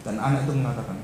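ordinary talk, nothing marked

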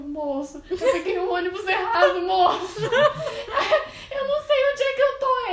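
high, loud mock crying through laughter; hammed-up wail of someone lost and unable to speak properly; retold tearful panic. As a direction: play nervous sobbing, half laughing. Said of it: "Aí eu não consigo falar direito".

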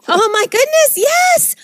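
high-pitched, exclaimed agreement; emphatic agreement. Real, not acted. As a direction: agree wholeheartedly, pitch leaping up on yes. Emphatic agreement about high school.

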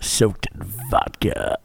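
low, slow, muffled mumble; mock slurred drunk mumble; mumbled. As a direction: drag it out low like a dopey mumble. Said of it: "You're talking like a dickhead, so it's hard to hear you".

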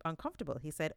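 ordinary delivery, nothing marked

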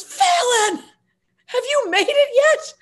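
very high, loud holler; imitated long-distance yell; mock shouting back. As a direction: shout up from the bullpen, drawn out. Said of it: "And he goes".

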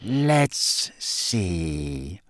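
slow, low, silky menace. A narrator's reading. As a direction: draw it out softly and silkily. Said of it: "he said in his silkiest voice".

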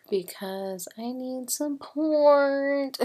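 loud, high, stretched-out plea; drawn-out plea. Real, not acted. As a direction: drag the last word out in a playful beg. Playful plea closing the show.

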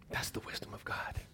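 low, barely voiced whisper; hushed awe. Real, not acted. Preacher closing the restaurant story, whispered in awe. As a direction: breathe it out as a revelation.

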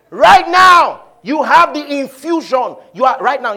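high, loud exhortation; fired-up preacher; preaching shout. Real, not acted. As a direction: drive it home at full volume.

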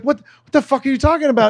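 high, fast, confused protest; baffled outburst. Retold or imitated. Half-asleep boyfriend confused by her panic, high and fast. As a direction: woken up and lost, blurting it out. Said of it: "And I was like".

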